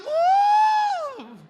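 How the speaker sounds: very high loud whoop; flying cheer. Acted. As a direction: whoop like you are soaring up. (masayang sigaw ng paglipad)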